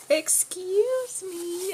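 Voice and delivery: very high, very slow, drawn-out call; high cartoonish excuse me stretched out long; calling after her. Chance calling after Coco.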